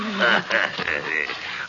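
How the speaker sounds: madman's gloating laugh; ghoulish chuckle. Acted. Madman recalls a victim to his captive, ghoulish chuckle. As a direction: chuckle at a grim memory.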